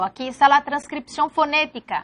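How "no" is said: ordinary delivery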